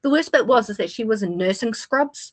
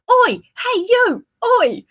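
high, slow hollered oi hey you; imitated shrill calling out; mock yelling oi; 5 dB louder, much slower than usual. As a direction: bellow it across a packed car park. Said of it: "she goes".

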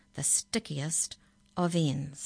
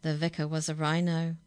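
lively rhyming read-aloud, louder as it builds; reading a funny poem to children; 2 semitones higher, 4 dB louder than usual. A narrator's reading. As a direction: bounce through the rhymes.

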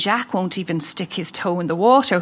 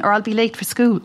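normal talking voice

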